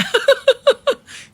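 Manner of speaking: shrill mocking cackle; high laugh. Real, not acted. Taunting the reporter with a high laugh.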